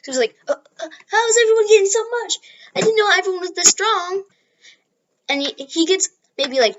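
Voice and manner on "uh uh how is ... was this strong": high, whimpering, stammered worry; high, nervous near-cry; timid panic. Retold or imitated. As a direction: sound overwhelmed and a little scared. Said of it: "he's kind of timid still".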